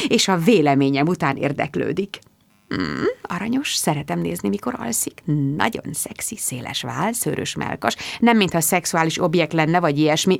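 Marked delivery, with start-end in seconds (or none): 2.7-8.0 soft dreamy murmur, much softer; hushed musing. A narrator's reading. Narrator murmuring while watching Mark sleep, softly. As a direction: murmur it close, not to wake him.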